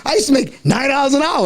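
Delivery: high-pitched indignant mom impression; mother's voice. Mom slipping up while scolding her child, indignant. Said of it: "she's like".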